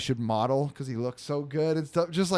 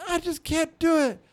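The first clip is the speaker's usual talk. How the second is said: very high mock child whine; pouty, high-pitched imitation of a kid; whining kid; 11 semitones higher than usual. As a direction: give up in a whiny little-boy voice. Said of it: "Stop whining".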